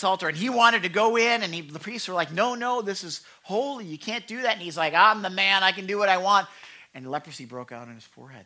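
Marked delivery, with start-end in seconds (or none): 2.3-4.5 alarmed priests. Retold or imitated. Priests stop the king. 4.9-6.5 arrogant king. Retold or imitated. King defies priests.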